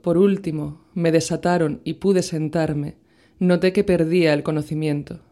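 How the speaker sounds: ordinary delivery, nothing marked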